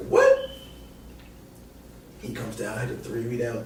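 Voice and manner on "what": very high, loud "what?"; outraged at the trash talk; shocked. Retold or imitated. [name] reacting to Evan Turner, very high shocked. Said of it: "I'm like".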